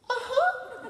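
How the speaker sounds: put-on high-pitched squeak of a crying girl; tearful mock reply. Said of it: "I saw a girl cry".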